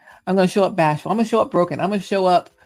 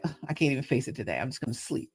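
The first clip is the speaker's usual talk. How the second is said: soft, fast, fading mumble; a weary mumble trailing away; sleepy groan; 4 semitones lower, 11 dB quieter, faster than usual. Retold or imitated. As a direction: sink into bed and give up. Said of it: "So I'm like".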